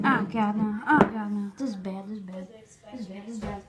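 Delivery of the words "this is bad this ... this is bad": soft dismayed muttering. Real, not acted. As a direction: mutter it under your breath. Hand burned, muttering in dismay.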